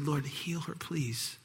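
soft, breathy plea; whispered prayer. Acted. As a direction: whisper it gently. Moses' true prayer whispered.

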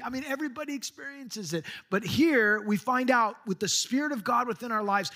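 usual speaking voice

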